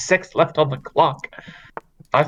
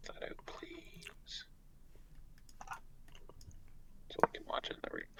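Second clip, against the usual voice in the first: whispering; 25 dB quieter than usual. Real, not acted. Aside off mic.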